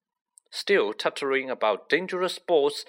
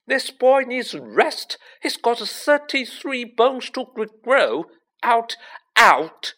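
high, slow, scolding shout; 12 semitones higher, 7 dB louder, slower than usual. A narrator's reading. School matron ordering visitors away from her patient, shouting.

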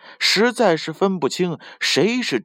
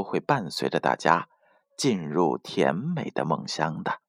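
lower, softer lulling goodnight; bedtime sign-off; 6 semitones lower, 3 dB quieter than usual. Acted. Storyteller tells the little one to close eyes and sleep, soft and low. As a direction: ease the child toward sleep.

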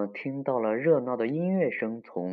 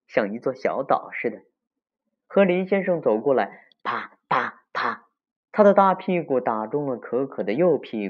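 lively storyteller, key phrases punched higher and louder; story reading to children; 4 semitones higher, 4 dB louder than usual. A narrator's reading. Telling children of Mr. Beaver's spanking, lively storytelling. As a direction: tell it vividly to the little ones.

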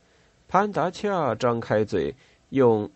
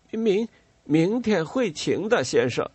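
a stuttered start, jumping much higher; stammering; 9 semitones higher than usual. A narrator's reading. Pantacha stammers to the lieutenant, pitched higher. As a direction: trip over the first word, anxious to please. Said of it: "潘达恰结结巴巴地说".

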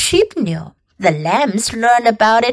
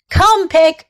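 higher summons to an animal; coaxing farmer; 6 semitones higher, 4 dB louder than usual. A narrator's reading. Farmer taps pail for the pig, coaxing. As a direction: lightly call the pig over. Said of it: "said Mister Zuckerman".

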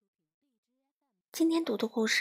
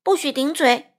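much louder sharp order; stern rebuke; 3 semitones higher, 7 dB louder than usual. A narrator's reading. Mother silencing the crow, loud stern rebuke. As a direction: cut the back-talk off firmly. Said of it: "欢妈妈说".

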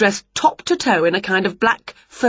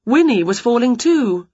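plain reading voice

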